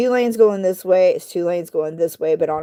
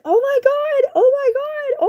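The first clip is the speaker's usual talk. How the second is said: very high, frantic oh my God; panicked shriek. Retold or imitated. Driver seeing the kitten run over, shrieking high. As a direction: shriek it in disbelief. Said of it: "I was freaking out".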